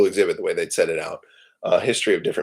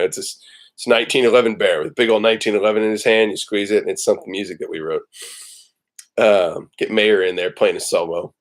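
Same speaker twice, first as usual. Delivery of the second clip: usual speaking voice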